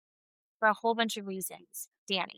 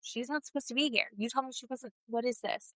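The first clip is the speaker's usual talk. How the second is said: Kristen; 8 dB quieter than usual. Retold or imitated. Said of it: "I think Kristen's like".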